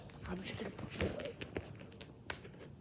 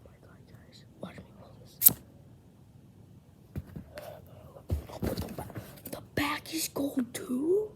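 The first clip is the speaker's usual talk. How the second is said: awed whisper; 7 semitones higher than usual. Real, not acted. Kid pulls rare card, awed.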